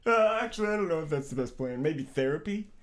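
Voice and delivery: mock a nervous nerd; timid objector. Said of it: "It's like".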